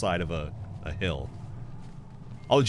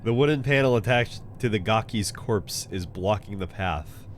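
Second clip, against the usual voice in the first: ordinary talk, nothing marked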